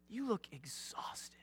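slow soft whisper; gentle whisper. Retold or imitated. Shepherd to the exhausted sheep, soft whisper. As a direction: breathe it out tenderly. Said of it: "you're like".

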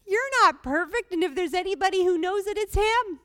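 high, loud, fast burst of preaching; preaching at full force. Acted. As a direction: drive the point home from the pulpit.

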